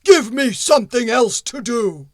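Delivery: loud, heavy stone golem. Acted. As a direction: boom it out like a stone giant. Golem demands work.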